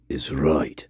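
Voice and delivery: whisper. Real, not acted. Quiet aside after long pause.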